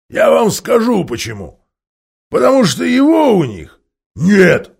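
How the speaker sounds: loud scornful dwarf; jeering dwarf. Acted. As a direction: gloat to the crowd, loud. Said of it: "выкрикнул он".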